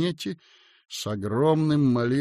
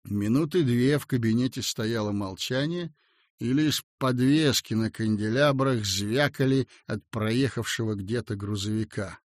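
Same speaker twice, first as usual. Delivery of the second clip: ordinary delivery, nothing marked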